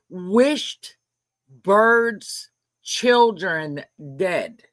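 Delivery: slowly spelling out each word, appalled; slow outrage. Real, not acted. Streamer shocked at a viewer's wish, slow emphasis.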